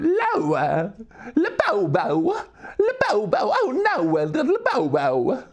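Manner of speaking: high, yelping, distorted goofy voice; iDubbbz. Retold or imitated. Streamer showing how fun weird talking is, high goofy voice. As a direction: swoop up absurdly on stretched vowels. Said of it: "twenty sixteen iDubbbz way of speaking".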